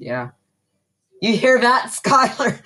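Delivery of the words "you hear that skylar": higher excited teasing call. Real, not acted. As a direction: tease her excitedly. Teasing the absent sister.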